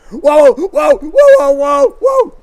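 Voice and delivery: high frantic shouted whoa; panicked shout. Retold or imitated. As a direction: yell whoa in a panic as the sled races. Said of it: "So I said".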